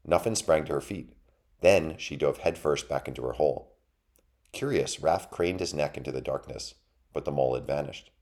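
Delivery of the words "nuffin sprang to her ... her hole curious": plain reading voice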